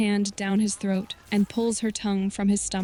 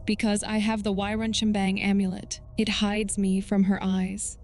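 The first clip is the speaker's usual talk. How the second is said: ordinary delivery, nothing marked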